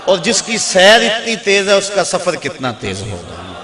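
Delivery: booming sermon punchline; impassioned preaching climax. Real, not acted. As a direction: drive the rhetorical question home at full voice, then let it fall. Preacher drives home climactic rhetorical question.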